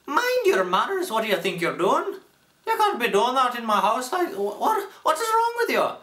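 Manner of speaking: very high, loud, scolding Irish accent. Shown as a demonstration. Teacher showing his Irish starter phrase, shrieky scolding Irish accent. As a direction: scold in a squeaky Irish brogue. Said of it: "say you're doing an Irish voice".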